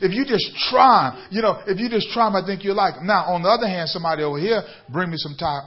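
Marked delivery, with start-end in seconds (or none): 0.0-1.3 louder, pressing push on try; coaxing salesman. Retold or imitated. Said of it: "Come on, Bishop". 1.3-3.1 quick, rolling coax; coaxing salesman. Retold or imitated. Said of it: "Come on, Bishop".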